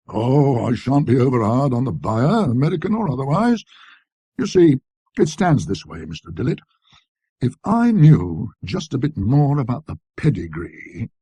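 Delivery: lightly voiced eager dealer; dealer's sales pitch. A narrator's reading. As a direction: lay it on like a shopkeeper selling hard.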